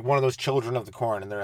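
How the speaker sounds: normal talking voice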